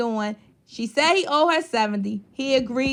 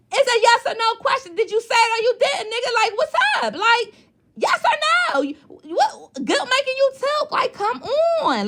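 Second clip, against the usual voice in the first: exasperated call-out; 11 semitones higher than usual. Real, not acted. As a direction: press him like he owes you a yes or no. Berating him for dodging the question.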